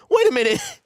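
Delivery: high, rising wait a minute; surprised. Real, not acted. Host suddenly realises who Nick Gates is, surprised. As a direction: catch yourself in sudden disbelief.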